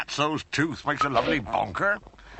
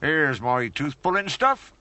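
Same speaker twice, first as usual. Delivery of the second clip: ordinary delivery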